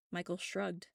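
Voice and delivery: usual speaking voice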